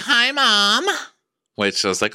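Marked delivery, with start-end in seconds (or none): 0.0-0.9 much higher, louder squeaky Hi Mom; mock Lindsay. Lindsay hugging Danielle, mock high squeaky greeting. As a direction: pitch it way up, daughter greeting. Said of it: "she's like".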